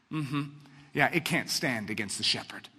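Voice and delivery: ordinary talk, nothing marked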